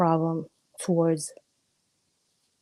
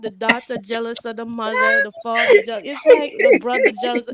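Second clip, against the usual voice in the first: high, loud, giggly rant; laughing through the list; excited laughing rant; 6 semitones higher, 4 dB louder than usual. Real, not acted.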